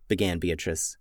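usual speaking voice

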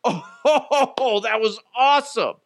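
high laughing exclamation; laughing delight. Retold or imitated. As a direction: cheer it through a laugh. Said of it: "I'm like".

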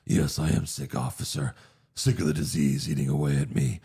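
low, measured villain impression; grave, deep-voiced imitation of a film villain; Jigsaw. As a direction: put on a low menacing killer voice. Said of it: "where Jigsaw's like".

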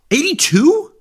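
high-pitched incredulous echo; astonished. Real, not acted. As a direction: question the age in real disbelief. Reacting to skater's surprising age.

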